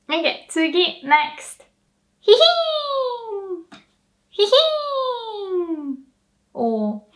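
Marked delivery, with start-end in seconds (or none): 2.2-6.0 very high whinny hihin; horse whinny. Shown as a demonstration. Teacher imitates a horse neighing. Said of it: "the sound horses make".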